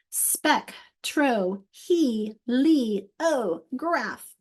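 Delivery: spectroheliograph split into separate beats; teacher chunking a long word; syllable by syllable. Shown as a demonstration. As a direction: tap out each syllable of spec tro he li o graph. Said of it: "Awesome job".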